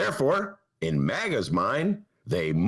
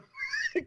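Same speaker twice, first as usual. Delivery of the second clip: real laugh; 6 dB quieter than usual. Real, not acted. Laugh after the quote.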